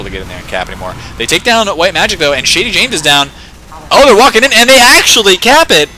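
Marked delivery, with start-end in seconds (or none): 1.2-3.3 raised, louder play-by-play; high, punchy commentary; excited kill call. Real, not acted. Calling kills in live match. 3.9-5.9 high, very loud commentator's yell; explosive play-by-play peak; astonished shout. Real, not acted. Astonished call of surprise capture.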